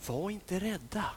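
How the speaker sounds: quiet gentle "rädda"; soft reassurance. Acted. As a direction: say it softly to calm them. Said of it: "fick de höra just de här orden".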